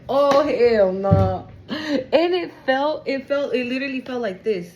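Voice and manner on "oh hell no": drawn out, louder hell no; slow stunned disbelief. Real, not acted. Reader stunned by the cards. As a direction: slowly refuse to believe it. Reacting to card.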